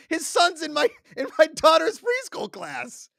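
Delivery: high, loud disbelief, voice way up; incredulous outburst. Real, not acted. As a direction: blurt it out in astonished high-pitched near-shout. Amazed reveal of the golfer's career.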